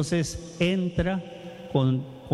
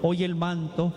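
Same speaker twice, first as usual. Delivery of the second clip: tender altar call. Acted. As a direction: invite them forward gently, word by word. Altar call over music.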